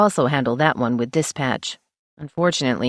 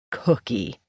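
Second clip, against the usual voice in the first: low, softer, full of disgust; 4 semitones lower, 4 dB quieter than usual. Acted. Janet scorning the meek widow, disgusted. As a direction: spit the name out in contempt. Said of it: "Janet cried in disgust".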